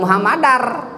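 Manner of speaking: "Muhammadar" with a rolled final R; wrong trilled ending. Shown as a demonstration. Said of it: "bukan Muhammadar". ("Muhammadar" dengan r bergetar)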